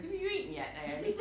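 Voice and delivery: a hint of the calm husband asking; husband's dry question. Husband calmly asking his upset wife, lightly voiced. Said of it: "Mark turns around and goes".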